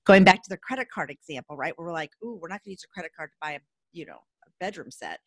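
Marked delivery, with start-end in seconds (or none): 2.2-5.2 much softer, fast, put-on prissy voice; mock prim caution. Host mocking how women fear credit cards, soft and fast. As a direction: sniff at the idea like a prim lady.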